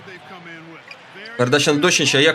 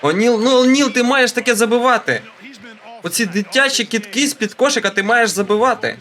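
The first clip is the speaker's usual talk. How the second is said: exasperated scolding; 7 semitones higher than usual. Real, not acted. Scolding O'Neal for missing.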